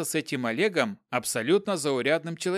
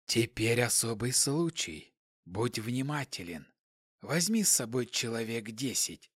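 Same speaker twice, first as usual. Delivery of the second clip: quiet, emotionless hissing voice; 4 semitones lower, 7 dB quieter than usual. A narrator's reading. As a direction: hiss it softly, without feeling. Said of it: "Шипящий голос не выражал никаких эмоций".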